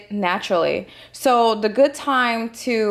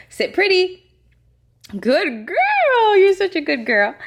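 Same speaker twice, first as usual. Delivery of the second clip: talking to a dog; 10 semitones higher than usual. Real, not acted. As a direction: squeal with delight at the pup. Delighted praise of dog trick.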